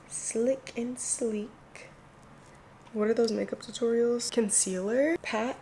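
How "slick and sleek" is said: whisper. Real, not acted. Describing wanted edges, hushed.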